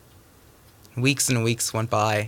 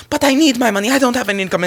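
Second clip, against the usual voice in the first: high, loud protest; yelling in argument; 12 semitones higher, 5 dB louder, faster than usual. Acted. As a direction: yell back in a high voice. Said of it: "Zelda yelled out".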